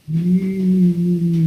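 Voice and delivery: steady loud closed-mouth hum; a full audible mm; hummed mm. Shown as a demonstration. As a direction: hold one even hum. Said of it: "Instead of going".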